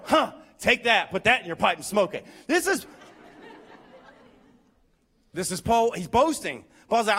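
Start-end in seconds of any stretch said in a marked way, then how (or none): none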